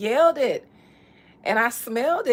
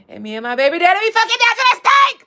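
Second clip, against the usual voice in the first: high, loud, fast [name] quote; imitating [name]; 11 semitones higher, 10 dB louder, much faster than usual. Friend retelling a viral clip, mimicking a high loud yell. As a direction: belt it high like Cardi going off. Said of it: "because of the elevation in her voice".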